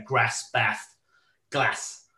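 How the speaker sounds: northern English short flat a in grass, bath, glass. Shown as a demonstration. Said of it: "where they say things like".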